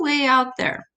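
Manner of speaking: normal talking voice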